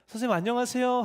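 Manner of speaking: greeting said high and bright, like a schoolboy; boy greeting the teacher. Retold or imitated. Father telling his son how he greeted the teacher, high and chirpy. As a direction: pipe up a cheerful 안녕하세요 as a kid would. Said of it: "하고 인사했더니".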